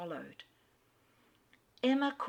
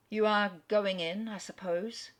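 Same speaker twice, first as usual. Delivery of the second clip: depressed; 2 semitones higher, 5 dB louder than usual. A narrator's reading. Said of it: "the depressed manner in which he still spoke".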